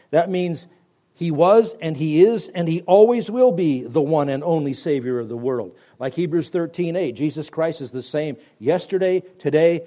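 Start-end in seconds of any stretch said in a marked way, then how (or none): none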